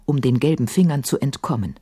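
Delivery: usual speaking voice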